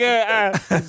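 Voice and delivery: ordinary talk, nothing marked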